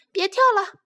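high, loud cry of stop; little sister shouting to stop. Acted. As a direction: yell at her brother to stop jumping. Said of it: "熊妹妹喊".

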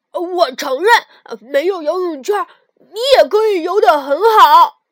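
loud angry retort. Acted. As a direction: fire back in anger. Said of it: "跳跳也发怒了".

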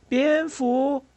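put-on high-pitched child's call. A narrator's reading. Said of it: "迈特喊道". (高声呼喊)

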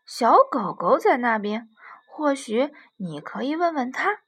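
loud, bouncy voice of a monkey with an idea; eager little monkey. Acted. Monkey suggests the giraffe ask the puppy, eager and loud. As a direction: burst out with a helpful suggestion.

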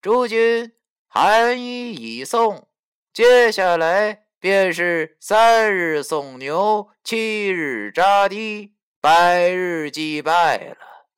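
high, slow, drawn-out ceremonial announcement; solemn clerk announcing. Acted. Funeral clerk announces the rites to mourners, high and slow. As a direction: intone each rite slowly like a funeral official. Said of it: "这时文书便说".

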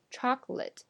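Chocolate as CHOK-lit; Chocolate with no middle vowel; syllable dropped. Shown as a demonstration. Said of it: "而不是chocolate".

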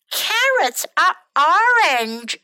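high, exasperated storybook yell; acted shout. A narrator's reading. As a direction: protest loudly at the offer. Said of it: "shouted Bird".

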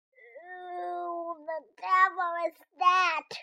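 put-on a slow, booming grumpy old man's shout from indoors; gruff shout. A narrator's reading. Grumpy hermit shouting at a knock on his door. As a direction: bellow it like a hermit disturbed at home. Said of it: "shouted a voice from inside".

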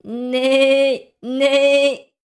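high, loud storybook neigh; drawn-out voiced "neigh" like a horse. A narrator's reading. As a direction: imitate a horse's neigh with gusto. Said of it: "said the horse".

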